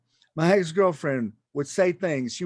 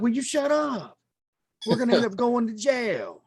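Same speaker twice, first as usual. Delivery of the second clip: high-pitched, worked-up comic protest; exasperated plea; 8 semitones higher than usual. Retold or imitated. Boyfriend pleading with girlfriend who confesses to police, exasperated. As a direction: plead in a raised pitch, as if retelling your alarm.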